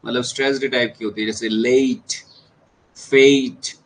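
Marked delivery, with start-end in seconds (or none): 1.5-3.8 लेट and फेट each stressed and drawn out, with a pause between; emphatic long vowel in लेट and फेट; stressed syllable. Shown as a demonstration. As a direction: lean hard on लेट, then louder on फेट. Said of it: "sound stress दे रहे हो आप".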